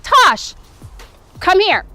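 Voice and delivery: sharp high call across the field; coach calling out. Retold or imitated. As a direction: call her name out loud from the line. Coach calls player out.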